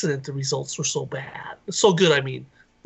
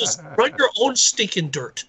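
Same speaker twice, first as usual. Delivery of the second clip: higher mock-indignant uncle, scolding; 6 semitones higher than usual. Stingy uncle telling his nephew off, mock indignant. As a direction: toss it off as the grumpy uncle.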